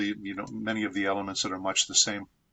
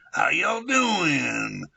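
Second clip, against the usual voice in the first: slow, high Texan drawl on "How y'all doing?"; Texan accent; 6 semitones higher, much slower than usual. Shown as a demonstration. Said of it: "like a Texan".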